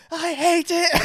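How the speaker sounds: high, loud comic outburst; mock horrified shout. Child reacting to mum's perm, horrified mock shout. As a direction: cry out in hammed-up horror. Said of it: "I just, like, went".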